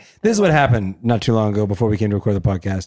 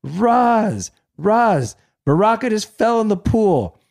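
retold yell; 9 semitones higher, slower than usual. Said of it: "And I yell".

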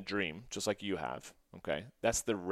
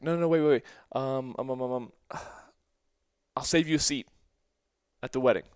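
much higher, louder, urgent begging; desperate not to let her leave; pleading; 4 semitones higher, 5 dB louder, faster than usual. Retold or imitated. Son begging his departing mother to wait, pleading.

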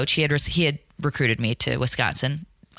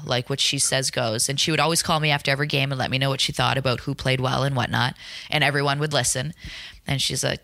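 ordinary talk

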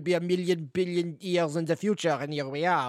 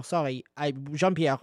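ordinary talk, nothing marked

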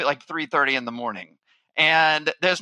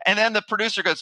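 ordinary delivery

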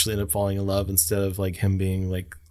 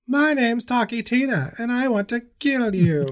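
much higher doll voice, sweetly menacing; creepy doll voice; 13 semitones higher than usual. Retold or imitated. Imitating the talking doll threatening Homer, high and sweet. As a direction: chirp the threat like a toy doll. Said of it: "it's like".

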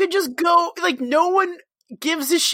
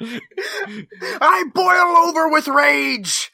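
put-on old prospector; 4 semitones higher, 4 dB louder than usual. Playing raging Old Man Foster.

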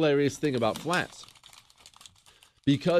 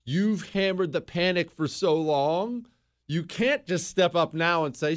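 ordinary talk

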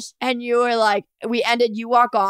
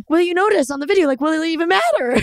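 higher, sweet innocent question; 7 semitones higher, faster than usual. Retold or imitated. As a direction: innocently ask the partner, a sweet higher lilt. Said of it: "sweet and innocent".

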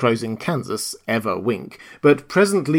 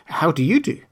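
put-on polite girl's higher voice, rising at the end; 6 semitones higher than usual. A narrator's reading. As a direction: return the greeting courteously, lifting the last word. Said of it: "replied Dorothy politely".

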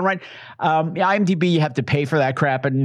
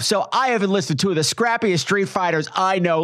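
ordinary talk

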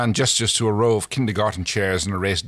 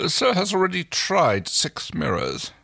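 thin reedy voice; 8 semitones higher than usual. Acted. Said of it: "The assistant's reedy voice cracks".

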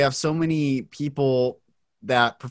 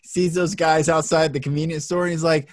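usual speaking voice

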